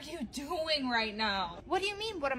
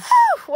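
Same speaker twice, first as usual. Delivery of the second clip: relieved whew; 16 dB louder than usual. Acted. After workout.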